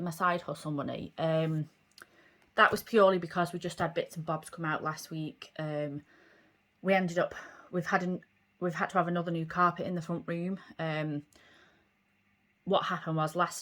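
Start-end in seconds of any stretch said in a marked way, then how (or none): none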